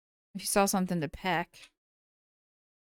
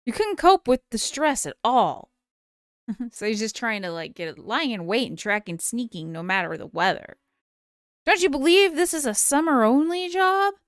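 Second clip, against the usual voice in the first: assassin voice; 3 semitones higher, 3 dB louder than usual. Acted. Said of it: "I'll do the assassin voice".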